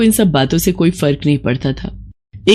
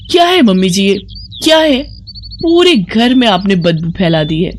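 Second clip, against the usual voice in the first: angry complaint; 2 semitones higher than usual. Acted. Bride complaining about the cow-dung smell, angry. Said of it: "गुस्से में सुप्रिया से कहा".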